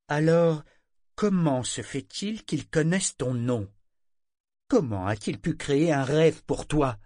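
insistante. A narrator's reading. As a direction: press hard for an answer. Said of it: "insista Ronica". (interrogatoire insistant et appuyé)

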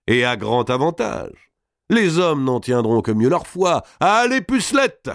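declamation climbing to a very high call; rousing, theatrical encouragement; hearty master of ceremonies. Acted. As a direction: announce it, then call out to the singers. Robert heartily urges ladies and singers.

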